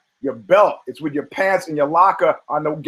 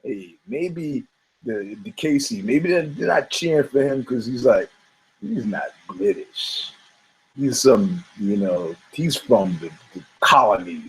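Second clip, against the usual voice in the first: wry inner thought; 6 semitones lower, 3 dB quieter than usual. Retold or imitated. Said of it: "I thought it was like".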